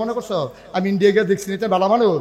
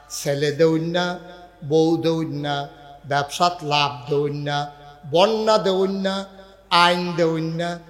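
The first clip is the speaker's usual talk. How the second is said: slow, low, softer preaching list; preaching litany; 5 semitones lower, 5 dB quieter, slower than usual. Acted. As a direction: intone each attribute slowly.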